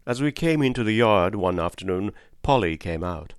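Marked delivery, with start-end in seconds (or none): none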